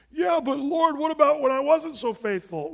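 high, loud, worried pleading; anxious believer. Acted. Believer standing before God, anxiously doubting. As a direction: fret like a nervous kid.

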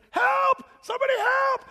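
loud, very high mock-terrified shout; panicked cry for help. Man in gorilla suit cornered by lion, hammed-up panicked shout.

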